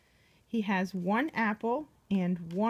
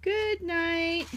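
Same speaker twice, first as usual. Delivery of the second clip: high, gentle good night; sing-song good night; 7 semitones higher than usual. Shown as a demonstration. Reading to a child, a high bedtime good night. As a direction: coax a child to sleep. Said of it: "Can you say good night?".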